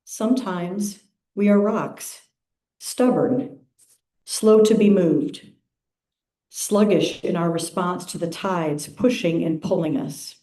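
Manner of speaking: unhurried, measured phrases with long pauses; calm, spacious meditation reading; guided meditation. Acted. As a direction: lead the congregation into stillness, phrase by phrase.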